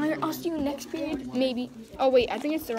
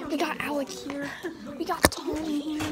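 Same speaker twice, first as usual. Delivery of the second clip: ordinary talk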